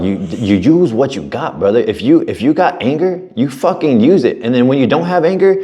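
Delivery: high-pitched, impassioned retold coaching; fired-up pep talk. Mentor telling an angry client to use it, fired up. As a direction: drive it home like a worked-up coach.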